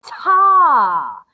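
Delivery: TA said short and punched like a beat; "Ta." one sharp loud beat; rhythm syllable. Shown as a demonstration. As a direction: hit "Ta." as one loud clap. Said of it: "The first one is called".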